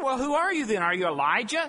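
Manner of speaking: questioning priests. Acted. Priests question John, acted.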